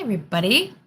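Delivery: usual speaking voice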